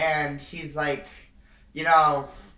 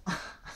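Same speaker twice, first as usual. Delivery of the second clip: quiet little chuckle; soft laugh; 9 dB quieter than usual. Real, not acted. Vlogger joking about a menthol smell, soft laugh. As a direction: laugh softly at your own joke.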